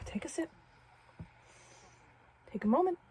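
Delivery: quiet self-talk to calm nerves; muttering to himself. Real, not acted. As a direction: softly coach yourself to breathe. Calming himself before recording.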